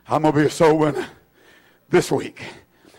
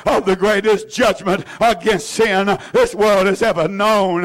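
ordinary delivery

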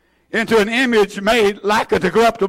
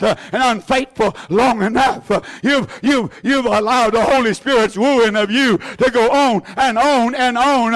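normal talking voice

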